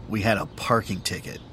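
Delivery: usual speaking voice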